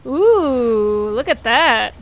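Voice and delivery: ordinary delivery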